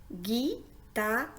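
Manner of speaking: "guitare" broken up as gui, ta, re; split into syllables. Shown as a demonstration. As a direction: sound out "guitare" slowly in three separate syllables. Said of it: "composé de trois syllabes". (« guitare » syllabe par syllabe : gui, ta, re)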